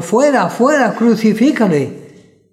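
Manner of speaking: high loud yell, slowing at the end; crowd shouting. A narrator's reading. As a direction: cry out like an angry mob. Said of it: "Pero ellos gritaron".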